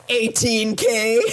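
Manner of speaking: slow, laughing between words. Real, not acted. Telling the host about the long run, laughing. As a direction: giggle out the distance.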